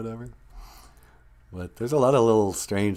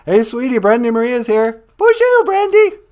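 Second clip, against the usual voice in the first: much higher, louder squeaky pet voice; pet's voice; 13 semitones higher, 9 dB louder than usual. Pretend. Host greeting viewers on behalf of his pet, much higher cutesy voice.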